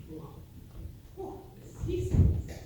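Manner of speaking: high, loud, breathy ooh of disgust; disgusted groan. Acted. Sailor recalls the seasickness on the crossing, disgusted. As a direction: groan at the memory of being sick.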